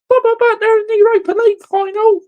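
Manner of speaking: high, whiny mock voice of a defending fan. Ranting fan mimics rival fans' excuses, whiny mock voice. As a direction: sneer it like a whining apologist.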